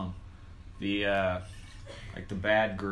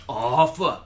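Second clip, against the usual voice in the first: a louder drawn-out porn "oh, fuck"; mock porn moan; 5 dB louder than usual. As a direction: imitate Squirrely Dan's porn noise. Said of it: "that's Squirrely Dan going".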